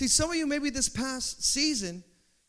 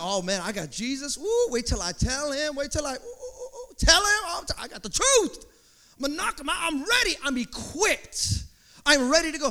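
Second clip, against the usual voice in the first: higher, gleeful "ooh"s; pumped-up excitement; 5 semitones higher than usual. Acted. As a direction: bounce with eager confidence. Confident believer pumped up.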